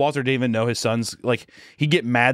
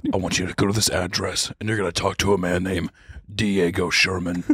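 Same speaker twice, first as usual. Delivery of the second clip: Heisenberg voice; 4 semitones lower than usual. Retold or imitated. Teacher ordering the student, low and menacing. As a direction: play the kingpin, coolly.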